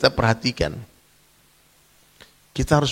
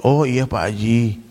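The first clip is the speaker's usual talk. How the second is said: meek compliance; 3 semitones lower, slower than usual. Retold or imitated. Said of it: "dia bisa mendengar nasihat".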